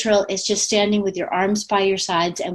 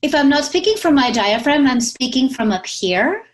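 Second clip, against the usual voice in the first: higher, without diaphragm support; high thin head voice; 5 semitones higher than usual. Shown as a demonstration. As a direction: speak from up in the throat, high. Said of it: "if I can do it".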